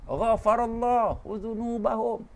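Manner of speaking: slow "Ghafarallahu dzunubahum" with a throaty gh; Arabic pronunciation. Shown as a demonstration. Preacher demonstrating the Arabic root. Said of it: "Kena ada rain".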